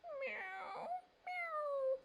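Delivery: very high mewing kitten cry; kitten meow. A narrator's reading. Kitten crying down from the tree, high mew. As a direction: mew like a small stuck kitten. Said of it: "came a cry from above".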